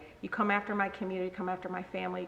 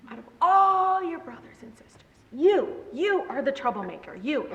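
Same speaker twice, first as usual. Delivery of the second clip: high, exasperated mom voice; scolding mother; 6 semitones higher, 4 dB louder than usual. Retold or imitated. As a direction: imitate a mother singling out the troublemaker. Imitates mother scolding her.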